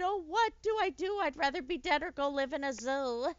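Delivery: high, loud despairing lion rant; a vain lion fretting about disgrace; panicked lion. A narrator's reading. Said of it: "he shouted".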